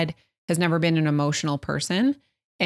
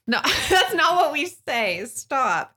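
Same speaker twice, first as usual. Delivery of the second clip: laughing protest; 9 semitones higher, slower than usual. Real, not acted. Wife laughing at husband's joke.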